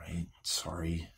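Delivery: slow, hushed apology; sheepish whisper. Acted. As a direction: whisper sorry after being scolded. Scolded teen apologizes.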